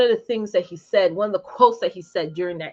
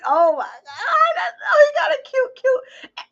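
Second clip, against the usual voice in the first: gushing; 5 dB louder, slower than usual. Real, not acted. Mom about her cute baby boy, gushing squeal. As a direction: squeal it way up high.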